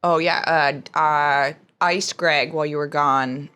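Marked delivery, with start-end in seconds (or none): none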